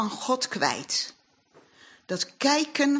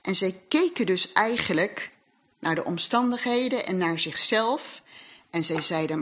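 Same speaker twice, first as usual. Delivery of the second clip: ordinary talk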